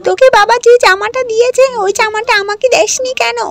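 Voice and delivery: high, loud, sneering stepsister voice; spiteful stepsister. Acted. Stepsister scolding Ragini over the dress, high and loud. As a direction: jeer at her, shrill and loud.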